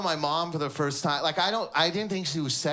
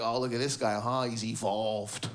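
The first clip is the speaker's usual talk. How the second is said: mocking brother; 8 semitones lower, 3 dB quieter than usual. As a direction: sneer it like a teasing brother. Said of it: "little brother's like".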